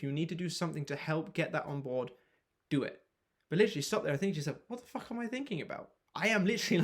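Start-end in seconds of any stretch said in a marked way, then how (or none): 4.7-6.8 cocky, incredulous self-talk, pitched up at the start; exaggerated arrogance. Shown as a demonstration. Coach demoing cocky self-talk to shut down doubts. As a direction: scoff at your own doubt like you're the best thing around. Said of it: "exaggerated sort of arrogance that I said that with".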